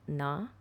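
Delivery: "na." as the plain dental n. Shown as a demonstration. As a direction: say the fourth-position "na.". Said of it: "a regular one".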